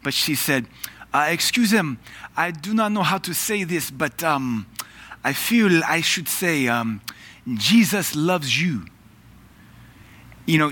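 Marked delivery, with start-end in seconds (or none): 1.3-8.9 put-on high, careful French-accented English; heavy French accent. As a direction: imitate a French woman groping for English words. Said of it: "she spoke with a heavy French accent".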